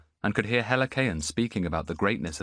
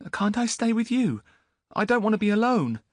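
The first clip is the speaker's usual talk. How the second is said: put-on much higher, louder boy's voice; young boy; 4 dB louder than usual. Boy to Antiochus, high bewildered voice. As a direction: sound lost and bewildered.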